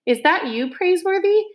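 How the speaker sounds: put-on high, muffled boy's voice from inside a barrel; young muffled voice. A narrator's reading. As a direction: call out muffled, like a boy in a barrel. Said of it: "came a young muffled voice".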